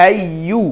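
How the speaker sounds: আইয়ু ending with a clearly rounded, lips-forward u; rounded vowel. Shown as a demonstration. Teacher models the rounded pesh on আইয়ু. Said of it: "ঠোঁট একদম স্পষ্ট পেশ উচ্চারণ হবে".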